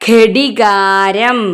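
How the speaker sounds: ഘടികാരം very slowly, syllable by syllable; slow model reading of ഘടികാരം; reading demonstration. Shown as a demonstration. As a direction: stretch out each syllable of ഘടികാരം. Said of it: "ഒന്ന് വായിച്ചേ".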